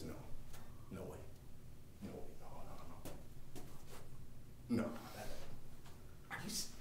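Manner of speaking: very soft, halting, stunned murmur; hushed disbelief. Acted. Kid spotting something as he walks away, hushed disbelief. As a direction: barely voice it, with long pauses.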